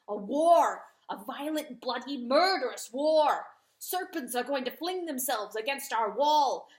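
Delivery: fierce rooster rant. A narrator's reading. Rooster warning the weeping dog of war. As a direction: hammer the warning home.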